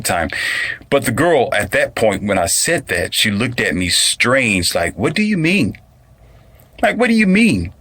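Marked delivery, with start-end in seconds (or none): 5.0-7.7 high incredulous mimic; squeaky confused imitation; mocking high voice. Imitating the girl's question.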